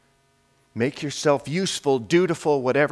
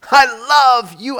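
loud; 11 semitones higher, 11 dB louder than usual. Acted. Repeated blessing, first louder.

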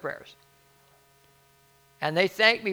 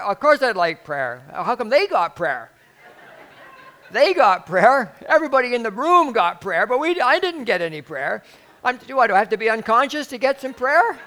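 high, loud indignant rant; imitated bawling out; 7 semitones higher, 11 dB louder than usual. Only conscious patient demanding prayer, mock outraged bawling. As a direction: belt out the grievance, hammed-up. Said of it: "bawling me out".

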